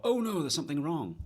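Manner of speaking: high, loud mock alarm; a bit of hammed-up panic over the guitar; retold panic. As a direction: replay the sudden worry that something broke. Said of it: "I thought".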